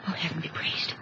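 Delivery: soft breathless whisper of thanks; a relieved wife whispering thanks; whispered relief. Acted. Relief after the boy breathes.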